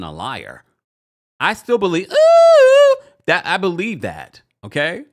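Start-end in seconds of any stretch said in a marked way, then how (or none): 2.1-3.0 sudden high loud ooh squeal. Real, not acted. Reacting to a viewer comment with a loud high squeal.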